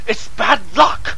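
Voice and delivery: frantic outburst of warning; pleading cry. Acted. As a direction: insist desperately. Fearful warning about whistling.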